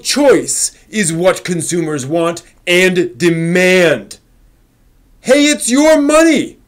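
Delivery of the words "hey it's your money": ordinary talk, nothing marked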